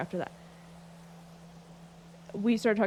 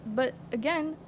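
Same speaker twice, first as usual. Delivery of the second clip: ordinary talk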